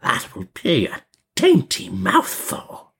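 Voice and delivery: slow, high, creaky witch voice; witch muttering to herself. A narrator's reading. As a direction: savour it slowly to yourself. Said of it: "she muttered to herself".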